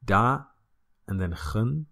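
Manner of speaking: "Da ... gen" said as two separate syllables with a pause; split into syllables. Shown as a demonstration. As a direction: break "Da" and "gen" apart, long open a. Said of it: "split these words up into syllables".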